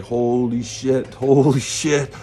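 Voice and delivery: overwhelmed, voice lifting; dazed awe rising. Retold or imitated.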